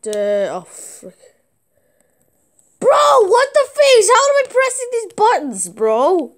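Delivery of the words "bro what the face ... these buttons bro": high, slow, stunned outcry; shocked disbelief. Real, not acted. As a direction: shriek it out in total disbelief. Baffled by game controls.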